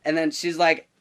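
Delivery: ordinary delivery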